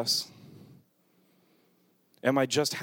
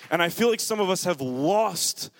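full-force preaching; 7 semitones higher than usual. Real, not acted. Pastor rallying the church to run to the roar, fiery preaching. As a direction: build the fire and keep it up.

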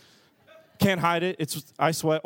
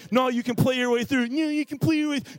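higher, hammed-up encouraging friend; mock cheerful coaxing; 6 semitones higher than usual. Friends coaxing preacher to keep playing, mock cheerful. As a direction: parody the overly upbeat buddy. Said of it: "They try to be all encouraging".

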